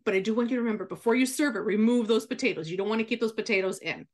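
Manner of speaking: usual speaking voice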